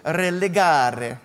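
Religare said slowly in an Italian accent, with a rolled r and an open ah. Shown as a demonstration. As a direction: savour Religare the Italian way, drawing it out. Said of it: "I'm saying it in an Italian accent".

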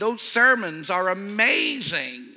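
high, loud, slow gushing American voice; American accent. Retold or imitated. American fan gushing to the preacher's wife in an American accent. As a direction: gush over the preacher in a hammed-up American accent. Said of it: "terrible with accents, but I'll try".

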